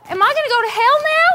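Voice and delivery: a touch of rising alarm; panicked. Acted. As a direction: ask it in quick fright. Panics after offending God.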